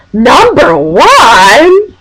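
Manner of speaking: very drawn-out, rising echo; imitated questioning reaction. Friend echoes the dress announcement, long mock-incredulous question. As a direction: stretch it out high and swoop up. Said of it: "then Pete goes".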